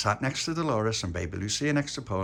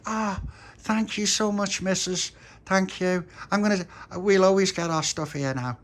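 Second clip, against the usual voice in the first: put-on grateful boy; 11 semitones higher, 4 dB louder, slower than usual. Tony thanking the shop lady.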